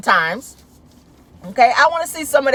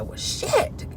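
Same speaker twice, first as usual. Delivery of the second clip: exasperated; 5 semitones higher than usual. Retold or imitated. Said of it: "I said".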